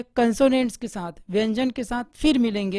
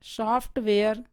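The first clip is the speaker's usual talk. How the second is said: ordinary delivery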